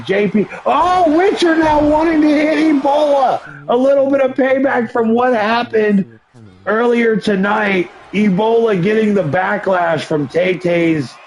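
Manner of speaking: high, energetic ringside wrestling commentary; excited play-by-play. Acted. Streamer calling his wrestling game match for viewers, excited play-by-play. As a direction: call every move like a live announcer.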